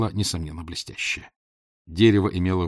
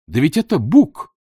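higher, louder sudden exclamation; surprised outburst; 7 semitones higher, 8 dB louder than usual. A narrator's reading. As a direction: blurt it out in surprise. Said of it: "невольно вырвалось у меня". (невольное удивлённое восклицание)

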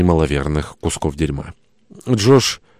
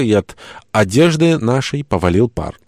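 ordinary delivery, nothing marked

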